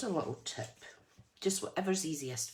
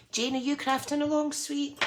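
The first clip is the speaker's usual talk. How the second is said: cheery greeting; 10 semitones higher, 4 dB louder than usual. Real, not acted. Greeting viewer in chat.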